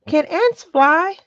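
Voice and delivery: put-on child's drawn-out curious question; high slow child question. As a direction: ask wide-eyed like a little boy.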